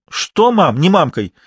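high-pitched scolding comeback; acted maternal anger; angry retort. A narrator's reading. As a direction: cut off the daughter's plea indignantly. Mother snaps at daughter's plea.

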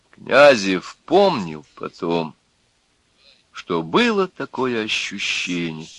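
usual speaking voice

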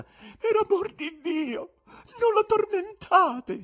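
very high, slow, loud entreaty; pleading woman's voice. A narrator's reading. Woman in a box to the magicians, pleading cry. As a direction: implore them over the uproar. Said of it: "una voce femminile che coprì il fracasso".

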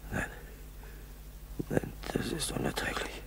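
whispered, spent with pain. Acted. Exhausted pilot alone in snow, pained whisper. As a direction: barely breathe the words out, hurting.

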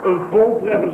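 ordinary talk, nothing marked

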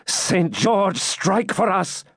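high, slow rallying cry; exclaimed prayer. A narrator's reading. Knight prays for his side's victory, fervent. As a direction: invoke the saint fervently, drawn out. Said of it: "exclaimed the knight".

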